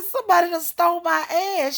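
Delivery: loud, high re-enacted child's wail; screaming and crying. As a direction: holler it like an outraged, tearful kid. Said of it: "I'm screaming and crying".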